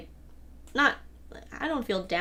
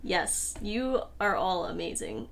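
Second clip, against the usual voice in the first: ordinary talk, nothing marked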